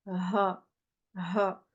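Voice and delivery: "ha" breathed from deep in the throat; deep resonant ha. Shown as a demonstration. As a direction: let "ha" resonate low without scraping. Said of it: "un résonnement au fond de la gorge".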